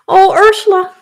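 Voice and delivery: a real high, loud cry of emotion, breaking; overcome, voice cracking with tears; about to cry. Real, not acted. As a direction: near tears, breaking into a whisper. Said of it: "I'm really gonna cry".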